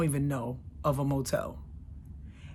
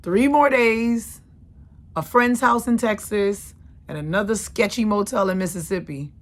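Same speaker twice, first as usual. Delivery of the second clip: triumphant; 4 semitones higher, 7 dB louder than usual. Real, not acted. Triumphant rest of trip.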